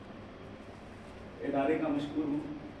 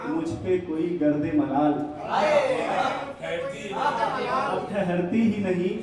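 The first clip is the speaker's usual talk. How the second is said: raised, projected Urdu verse declamation; poetry recital; 6 semitones higher, 11 dB louder than usual. Acted. Poet repeating lines for a cheering audience, declaimed ghazal. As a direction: recite to the hall, leaning on the refrain.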